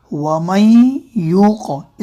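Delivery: वमन यूक़ very slow, noon hummed in the nose; slow nasal ghunna. Shown as a demonstration. Teacher repeating the correct nasal reading slowly. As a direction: stretch वमन यूक़ with a long nasal hum. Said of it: "नाक में आवाज़ गुन्ना होगा".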